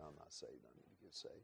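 barely audible, broken confession; a near-whispered admission of being lost; retold quiet plea at the altar; hushed confession.

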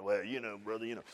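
low, hushed imitation of reserved churchgoers; subdued disapproval. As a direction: drop to a discreet, hesitant aside.

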